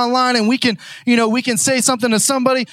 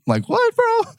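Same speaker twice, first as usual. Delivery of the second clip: incredulous; 12 semitones higher, slower than usual. Real, not acted. Incredulous reaction.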